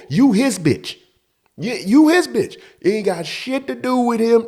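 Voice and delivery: high, insistent angry rant; acting out a heated confrontation; on ten. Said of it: "Now I'm on ten".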